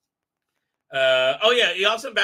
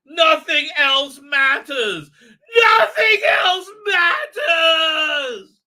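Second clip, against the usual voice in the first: slow sobbing yell that builds to a higher, louder fake wail; mock crying wail, building; 8 semitones higher, 7 dB louder, much slower than usual. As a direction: ham up a tearful tantrum, bigger each time.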